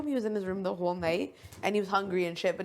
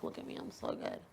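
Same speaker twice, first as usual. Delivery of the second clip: whispered mock brag; 11 dB quieter than usual. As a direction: sneer it in a whisper. Mocking Marty's bragging.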